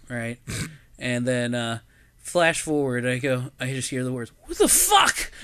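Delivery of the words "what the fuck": high-pitched shocked shout; startled outcry. Retold or imitated. Driver waking his sleeping friends in the car, startled outcry. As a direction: cry out in sudden alarm.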